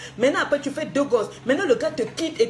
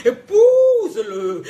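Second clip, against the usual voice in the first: slow, loud, high booming mock command laughed through; mock God's voice; 6 semitones higher, 7 dB louder, much slower than usual. Mocking the woman's claim, voices God booming and slow. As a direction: ham up a divine order through laughter.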